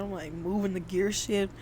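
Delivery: ordinary delivery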